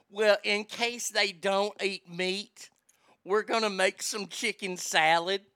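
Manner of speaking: slow imitated Southern lady; Southern drawl. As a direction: stretch it out in a mock Southern drawl. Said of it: "you would hear in the South".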